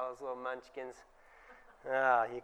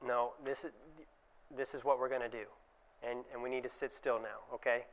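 firm, lower and softer, no nonsense; a quiet stern dentist to a child; sterner voice; 5 semitones lower, 11 dB quieter than usual. Shown as a demonstration. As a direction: set the limit calmly but firmly. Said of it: "I'm gonna use a sterner voice".

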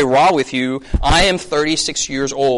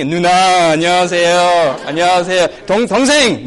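eager, louder mock greeting to an elder; hammed-up polite junior; deferential greeting; 4 dB louder, much faster than usual. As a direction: greet seniors with exaggerated respect. Acts greeting elders, then juniors.

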